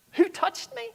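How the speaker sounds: high echoed question; echoing question. Acted. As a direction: toss the question back high. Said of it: "'Who touched me?'".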